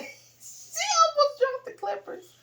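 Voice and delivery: high loud laughing; laughing shout. Real, not acted. Recounting the dropped clippers, laughing loudly.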